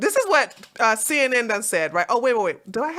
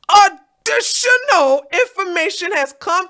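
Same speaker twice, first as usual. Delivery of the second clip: higher, louder and faster, building to a squeal; mock dramatic reading; 10 semitones higher, 6 dB louder than usual. Streamer reading the CNN statement, building to a giddy squeal. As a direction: ramp up like breaking gossip.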